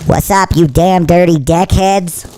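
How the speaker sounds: squeaky voice from inhaled helium. Real, not acted. Streamer greeting his deckheads on helium, squeaky. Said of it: "we're just gonna do the whole show like that".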